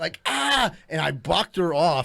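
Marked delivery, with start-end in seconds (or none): 0.2-0.7 high-pitched "Ah!" of sudden pain; mock yelp, pitched well up; retold pain yell. Telling how the nail went through his hand, high mock yelp.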